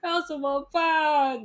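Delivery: soap-opera sister begging; mock tearful friend. Sister hugging her crying friend, hammed-up plea. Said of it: "他姐妹抱着他说".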